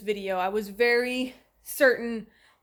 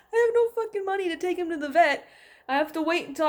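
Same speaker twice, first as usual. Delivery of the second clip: upset outburst; 7 semitones higher, 3 dB louder, faster than usual. Real, not acted. Upset about vet money.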